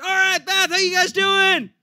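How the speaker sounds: high, loud hype-up call; stage greeting shout. Retold or imitated. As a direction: holler hello to a big crowd. Calling out to the theater audience.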